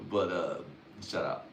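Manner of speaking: usual speaking voice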